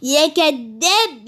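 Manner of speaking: taunting. Pretend. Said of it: "انا هبعت له فويس اقول له".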